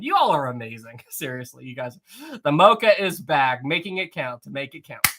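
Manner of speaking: normal talking voice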